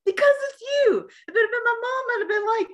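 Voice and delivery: very high, protesting imitation; squeaky-high mock defensiveness. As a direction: plead your excuse in a high voice. Acts out the actor's protesting answer.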